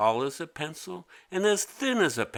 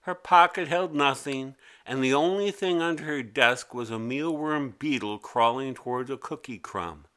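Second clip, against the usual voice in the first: usual speaking voice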